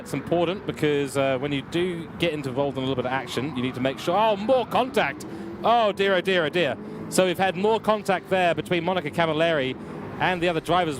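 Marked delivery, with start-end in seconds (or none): 4.2-6.8 surprised dismay. Real, not acted. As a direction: react to another crash. Second crash in the race.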